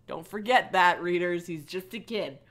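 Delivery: usual speaking voice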